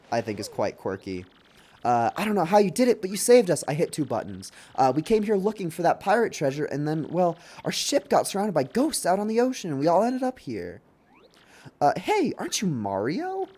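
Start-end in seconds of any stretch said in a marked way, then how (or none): 2.2-10.8 higher-pitched, animated character voice; game character. Acted. Reads game character's dialogue. 12.0-13.5 lightly voiced higher-pitched character; game character. Reads game character's dialogue.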